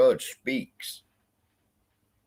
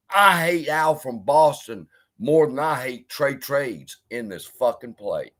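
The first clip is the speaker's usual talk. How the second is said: normal talking voice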